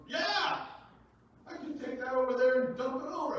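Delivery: put-on high, loud, cheeky kid threat; loud boastful child; defiant kid. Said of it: "And he goes".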